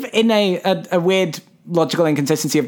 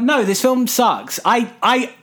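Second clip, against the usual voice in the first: ordinary talk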